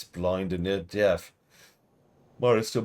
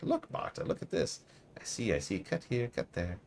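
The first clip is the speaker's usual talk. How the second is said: put-on high, muttering old seamstress, sing-song; croaky old seamstress; 6 semitones higher, 4 dB quieter than usual. Player asks who she is, old seamstress answers in high mutter. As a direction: play Marta as a batty crone. Marta talks to herself.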